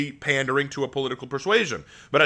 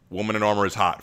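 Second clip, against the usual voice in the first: usual speaking voice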